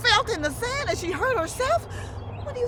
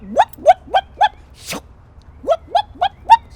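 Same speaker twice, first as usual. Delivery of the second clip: high loud whoop whoop cries; whipping sounds; 15 dB louder than usual. Acted. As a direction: belt out the whip noises. Acting out the whipping.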